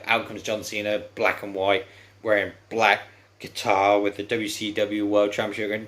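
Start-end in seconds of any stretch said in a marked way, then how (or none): none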